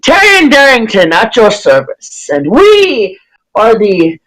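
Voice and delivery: over-enunciate with great pomp; pompous introduction. Acted. Human bowing as he introduces the party, pompous. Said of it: "overly pronounced and pompous".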